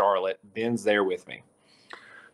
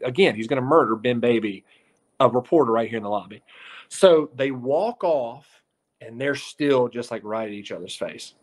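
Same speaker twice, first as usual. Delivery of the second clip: ordinary talk, nothing marked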